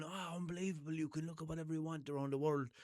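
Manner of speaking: high, wide-eyed mock wonder at the internet; amazed Fred Flintstone. Imitating Fred Flintstone marvelling at broadband. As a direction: gush like a caveman seeing the web. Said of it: "He wouldn't be going".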